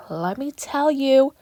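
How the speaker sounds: squealing with excitement; hyped shout-out. Real, not acted. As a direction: blurt it out over the moon. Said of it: "you get me so hyped and excited".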